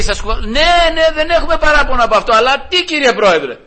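light mock wife protesting; hint of the wife. Wife answering the judge.

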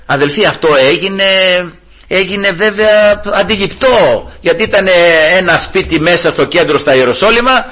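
ordinary talk

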